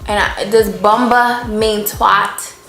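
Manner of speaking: ordinary talk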